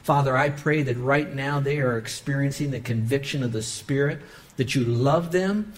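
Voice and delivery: hushed pastoral prayer; earnest prayer. Acted. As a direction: pray over the room gently. Leads the closing prayer.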